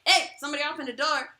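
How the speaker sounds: fast, loud announcing alarm. Pretend. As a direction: blurt it like a door alarm. Voicing the door alarm.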